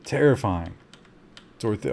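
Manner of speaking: ordinary talk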